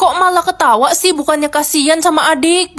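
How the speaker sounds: mother scolding Ren for laughing; angry scolding. Acted. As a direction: tell her off loudly.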